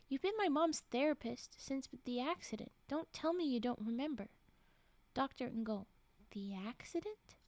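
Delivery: ordinary talk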